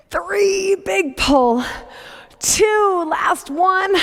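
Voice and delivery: high, effortful count; straining while rowing. Real, not acted. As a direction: squeeze the words out mid-pull. Coaching through own rowing set.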